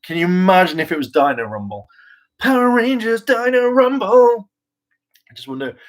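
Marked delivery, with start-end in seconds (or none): 2.4-4.5 high, announcer-style title call; mock announcer. Vlogger mocking a bad show name as an announcer. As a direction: sell the silly title like a trailer voice.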